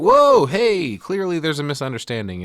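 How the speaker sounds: high startled "whoa" then hurried pleading; alarmed. Retold or imitated. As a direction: throw up your hands in sudden alarm. Chef panicking at gunpoint.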